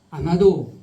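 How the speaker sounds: usual speaking voice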